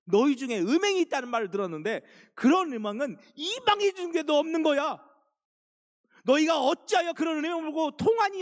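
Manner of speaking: the apostle Paul blasting the Corinthian church in fury; Paul's furious rebuke. Acted. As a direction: thunder at them like an outraged apostle. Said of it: "사도 바울이 버럭 화를 내는 거예요".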